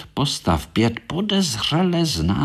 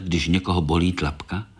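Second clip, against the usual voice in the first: ordinary delivery, nothing marked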